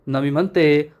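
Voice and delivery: plain reading voice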